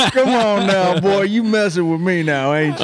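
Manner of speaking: put-on high, teasing good ol' boy; country boy voice. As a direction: needle him like a country boy. Continuing the country boy bit.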